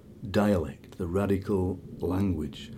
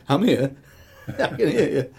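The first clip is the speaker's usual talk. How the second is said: higher, amused retort breaking into laughter; laughing protest; 7 semitones higher, 4 dB louder than usual. Retold or imitated. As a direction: protest through a laugh at being imitated. Laughs retelling his protest.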